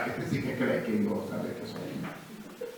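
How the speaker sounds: susurro lento y más grave; pensamiento en susurro. Retold or imitated. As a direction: murmura para sí, incrédulo. His private thought about the caller. (susurro lento de duda)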